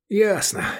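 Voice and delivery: high-pitched, wry reply; smirking. A narrator's reading. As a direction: toss it back with a smirk. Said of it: "усмехнулся Эдик". (с усмешкой, высоким голосом)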